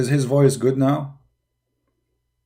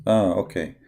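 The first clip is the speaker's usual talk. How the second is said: usual speaking voice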